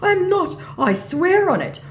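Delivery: high, loud mock protest; protesting scammer.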